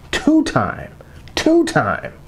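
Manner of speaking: TWO-TIME pitched high, falling on "time"; "two-time" repeated with a rising build; hyped-up repetition. Pretend. Hyping himself as repeat father.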